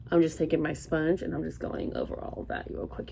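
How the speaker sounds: ordinary talk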